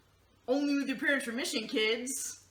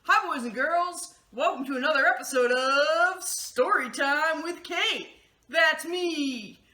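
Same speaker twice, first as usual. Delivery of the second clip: put-on cheery kids' host; 2 semitones higher, 4 dB louder than usual. Host welcoming kids to story time, in a loud cheery voice. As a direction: greet the children like a TV host.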